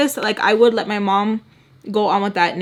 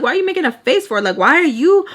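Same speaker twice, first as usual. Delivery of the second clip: high-pitched irritated questioning; pissed off; 5 semitones higher, faster than usual. Retold or imitated. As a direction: retell the annoyed challenge. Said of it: "So I'm pissed".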